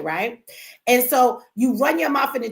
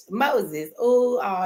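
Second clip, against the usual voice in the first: normal talking voice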